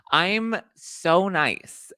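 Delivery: very slow, high sweet protest; slow, high, sugary claim of niceness; mock-innocent drawn out. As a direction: stretch it out, playing innocent. Defending self as nice.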